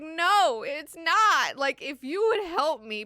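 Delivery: loud, high mock exasperation; insistent protest. Ghanima rejects Irulan's superstition claim, insistent protest. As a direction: contradict hotly, then appeal for help. Ghanima argues back, pleading.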